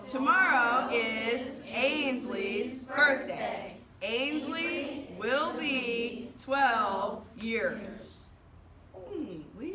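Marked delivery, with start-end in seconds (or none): 0.1-8.0 drawn-out, loud "Tomorrow is Ainsley's birthday"; big, slow classroom read-aloud; slow choral-reading lead. Shown as a demonstration. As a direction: stretch each word for the class to read along. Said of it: "read it the way we say it".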